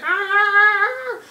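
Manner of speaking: high mock horn blare; sudden high honking noise; honk imitation. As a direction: imitate the car horn blasting. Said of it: "He's like".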